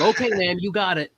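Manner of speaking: eager compliance. Retold or imitated. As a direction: snap to it for the boss. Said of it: "the whole rest of us went".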